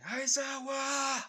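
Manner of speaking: long, high, drawn-out shout; stretched-out calling yell. Acted. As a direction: yell the name across a distance. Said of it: "yelling out to Aizawa, saying".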